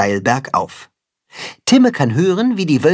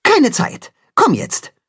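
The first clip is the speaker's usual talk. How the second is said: curt; 12 semitones higher than usual. A narrator's reading. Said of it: "antwortet knapp".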